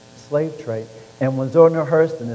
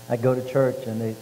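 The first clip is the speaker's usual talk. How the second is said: ordinary talk, nothing marked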